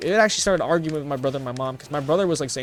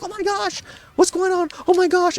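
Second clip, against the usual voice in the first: much higher, panicked mock squeal; hammed-up freak-out, pitched way up; imitating panic. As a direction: squeak it out like a frantic driver. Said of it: "you're, like, kinda freaking out".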